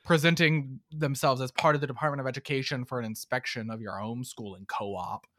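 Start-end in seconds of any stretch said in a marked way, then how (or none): 1.8-5.3 whispered trailing off. Real, not acted.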